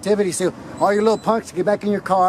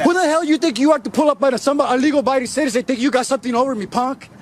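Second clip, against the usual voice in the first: angry yelling; 5 semitones higher than usual. Real, not acted. Auditor berates the officers who jumped out, yelling angrily. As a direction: tear into him like he's out of line.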